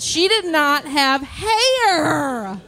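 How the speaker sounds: slow, drawn-out mock disbelief; exaggerated disbelief. Comedian insists the landlady was bald, drawn-out mock horror. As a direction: stretch it out in comic horror.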